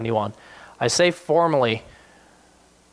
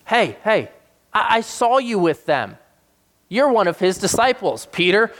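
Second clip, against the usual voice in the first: accusing servant; 7 semitones higher than usual. Retold or imitated. Servant girl accusing Peter.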